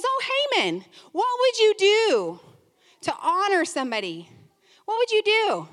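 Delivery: eager king. Acted. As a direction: lift it up high like an eager king. King voiced questioning Haman.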